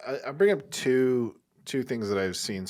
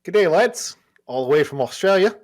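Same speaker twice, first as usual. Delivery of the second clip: put-on broad, higher and louder Australian accent; 8 semitones higher, 8 dB louder than usual. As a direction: read it out as a loud, lilting Aussie. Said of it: "you do a fucking killer Australian accent".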